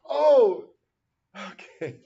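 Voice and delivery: loud, high cry of realization; sudden oh. Real, not acted. Teacher learning what totes means, surprised.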